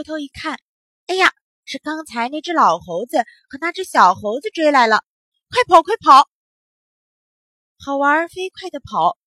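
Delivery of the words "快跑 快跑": loud, high alarm; urgent cry. A narrator's reading. Boy fleeing the chasing monkeys, urgently. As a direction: urge it out in a hurry.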